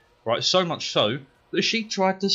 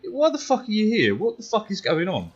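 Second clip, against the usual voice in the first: outraged disbelief; 11 semitones higher than usual. Retold or imitated. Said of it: "I'm sat there going".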